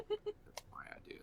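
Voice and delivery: high whispered claim; whispering. Acted. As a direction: whisper it like a secret. Claiming the joke, said twice.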